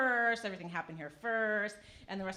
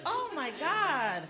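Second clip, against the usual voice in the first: exasperated; 7 semitones higher, 4 dB louder, much slower than usual. Retold or imitated. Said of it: "I'm like".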